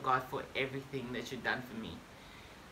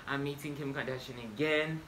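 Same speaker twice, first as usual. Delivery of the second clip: usual speaking voice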